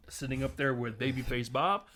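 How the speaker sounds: ordinary delivery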